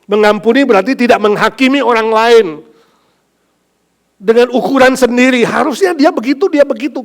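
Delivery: loud, high, emphatic sermon voice; preaching at full force. Acted. Pastor defining forgiveness to the congregation, loud and emphatic. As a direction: drive each point home hard.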